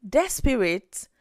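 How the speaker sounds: ordinary delivery, nothing marked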